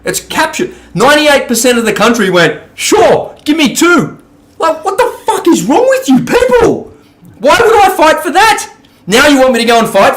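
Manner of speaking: high, loud exasperated fury; furious rant. Real, not acted. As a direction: let the outrage spill over, pitch way up. Furious rant about the country.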